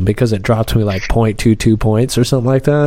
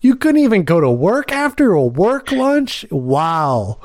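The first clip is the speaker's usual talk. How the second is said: much higher, mocking disbelief; incredulous scoff; 8 semitones higher, slower than usual. Real, not acted. Driver mocking a passenger drunk from a work lunch, incredulous scoff. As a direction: scoff at how absurd it sounds.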